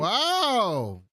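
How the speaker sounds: high, drawn-out real astonishment; amazed wow. Real, not acted. Host reacts to co-host's unexpected reference, amazed.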